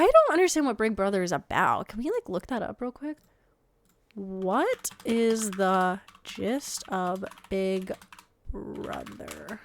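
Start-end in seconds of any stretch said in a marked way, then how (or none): 4.1-9.6 very slow, stretched-out words; typing aloud. Real, not acted. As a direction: draw out each word as if typing it. Saying a search query while typing.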